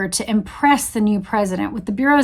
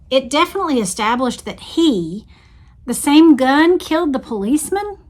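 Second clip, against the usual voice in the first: put-on Texan accent; 6 semitones higher than usual. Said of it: "put on my best Texan voice for L B J".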